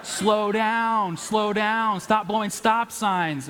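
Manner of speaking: high, loud mock panic, the last line slowed; mock screaming. Friend imitating the panicked passenger's screams, loud and high. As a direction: ham up a frantic passenger yelling. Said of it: "you are just screaming like an idiot".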